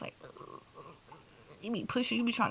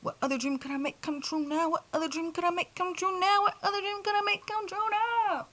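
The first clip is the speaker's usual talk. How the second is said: each repeat climbing higher; building excitement; 7 semitones higher than usual. Retold or imitated. To her viewers, the dream question rising higher every time. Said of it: "'cause I'm like".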